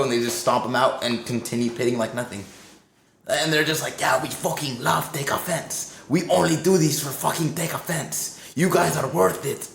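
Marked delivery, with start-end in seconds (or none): 4.0-9.7 high-pitched, fired-up fan imitation; all hyped up. Burning crew declares love for the band, wildly hyped. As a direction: proclaim devotion like an overexcited promoter. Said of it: "just all hyped as fuck".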